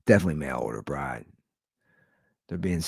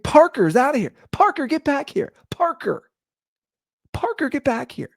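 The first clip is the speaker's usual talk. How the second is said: very high mock calling voice; 5 dB louder than usual. Neighbor calling his runaway dog, high frantic mock cries. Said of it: "he's like".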